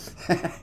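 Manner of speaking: quick soft chuckle; a brief laugh at own joke; short laugh. Real, not acted. Laughs after dope cake line.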